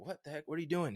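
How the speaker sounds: high, alarmed what the heck; startled uncle. Retold or imitated. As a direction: jolt awake and cry out in shock. Uncle wakes to him standing over.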